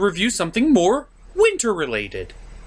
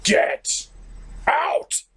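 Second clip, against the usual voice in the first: mock yell; 11 semitones higher than usual. Second shout, pitch jumps.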